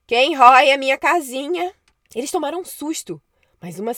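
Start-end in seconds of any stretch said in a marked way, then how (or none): 0.1-1.7 loud high witch yell from inside; yelling across the room. Acted. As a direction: shout it through the walls at intruders. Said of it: "uma voz gritou lá de dentro".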